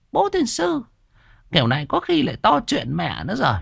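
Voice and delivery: put-on high-pitched nagging old shopkeeper; old woman voice. Old shop owner nagging the debtor, high old woman voice. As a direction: needle him about his debt like a sharp old woman.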